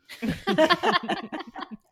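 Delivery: high loud burst of laughter. Real, not acted. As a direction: crack up laughing. Laughs at her story.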